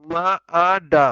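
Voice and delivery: মা আ ডা sounded out slowly, one syllable at a time; teacher sounding out মাড; spelling out sounds. Shown as a demonstration. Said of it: "তারপরে মাড".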